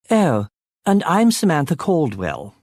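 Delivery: a plummy upper-class English lady introducing herself; a hint of 1930s film Queen's English accent. A narrator's reading. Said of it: "in an even more upper-class accent".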